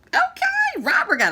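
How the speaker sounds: a loud, squealing okay of pride; excited okay. Real, not acted. Proud of reading Greene's book.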